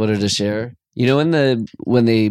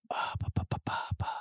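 whispered; 17 dB quieter, much faster than usual. Real, not acted. Searching for a word.

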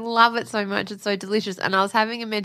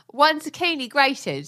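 higher, drawn-out British celebrity chef voice; Jamie Oliver impression; 4 semitones higher, slower than usual. Wife reads recipe on husband's request, British chef voice. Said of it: "Can you do it in a Jamie Oliver voice?".

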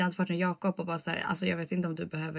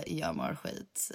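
much lower, softer and slow; gloomy low drop; 8 dB quieter, much slower than usual. Retold or imitated. Copying the vlogger line back, much lower, softer, slow. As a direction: drag it out low and deflated. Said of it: "that's you".

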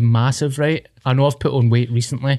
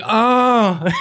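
caught out; 9 semitones higher than usual. Retold or imitated. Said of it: "And I was like".

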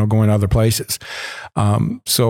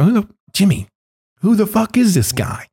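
incredulous question; 10 semitones higher than usual. Retold or imitated. Hushed urgent question to Jimmy.

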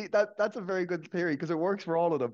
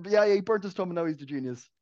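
ordinary talk

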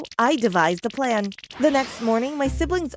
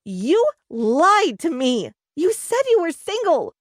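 high, drawn-out accusing yell; outraged, pitch climbing sharply; furious yell; 8 semitones higher, 4 dB louder than usual. A narrator's reading. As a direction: yell it at the man who lied to you. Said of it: "yelled Miss Barry".

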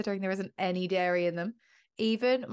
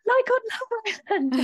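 laughing; 4 dB louder than usual. Retold or imitated. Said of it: "she was like".

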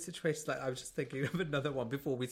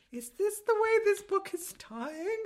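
much higher, slow incredulous question; mock disbelief; slower than usual. Questioning the book's direction in high disbelief. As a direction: ask it as if you can't believe it. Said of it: "I was like".

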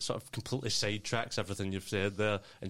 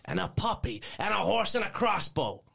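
put-on rising, louder excited kid; 11 semitones higher, 5 dB louder than usual. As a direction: build up to a squeal. Kid's wish list builds excitedly.

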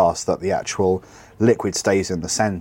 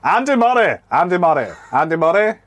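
high-pitched mock Scottish cry; Scottish accent; 8 semitones higher, 4 dB louder than usual. Shown as a demonstration. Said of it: "you hear my Scottish accent?".

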